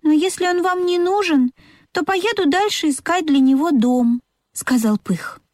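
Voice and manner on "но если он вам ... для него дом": high-pitched little train character; little train engine. A narrator's reading.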